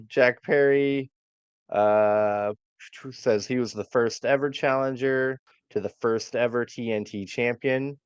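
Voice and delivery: ordinary talk, nothing marked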